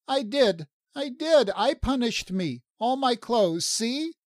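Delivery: put-on very high, insistent childlike protest; high-voiced Lala, upset. Lala insists she punished herself, high and agitated. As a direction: plead the case in a high-pitched voice.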